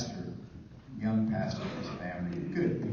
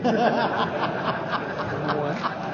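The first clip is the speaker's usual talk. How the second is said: laughing; 10 semitones higher, 6 dB louder than usual. Real, not acted. Laughing after his own joke.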